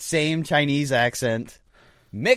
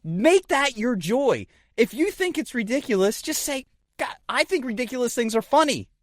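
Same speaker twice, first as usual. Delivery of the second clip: high preachy advice; 10 semitones higher than usual. Real, not acted. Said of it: "mock-preachy advice, pitch very high".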